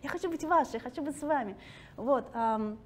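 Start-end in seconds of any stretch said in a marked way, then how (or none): 0.0-1.6 higher, louder mock protest. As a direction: insist loudly that you are Russian. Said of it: "Я такая". (шутливо возмущённо, выше и громче)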